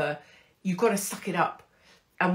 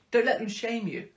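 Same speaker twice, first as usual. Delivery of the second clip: ordinary talk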